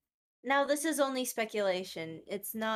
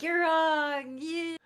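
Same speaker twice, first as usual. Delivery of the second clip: high, loud outburst of an upset fan; mock freak-out; 7 semitones higher, 5 dB louder, much slower than usual. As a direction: imitate someone freaking out, raised voice. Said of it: "freak out and be like".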